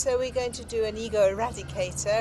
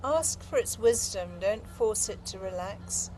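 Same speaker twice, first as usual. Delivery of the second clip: guided meditation; 5 dB quieter than usual. Acted. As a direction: gently invite inward attention. Opens with guided meditation cues.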